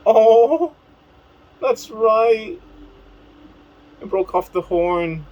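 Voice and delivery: put-on voice, pitched far up; high character voice. Character recalling breaking off the horn, high put-on voice. Voicing a story character's line.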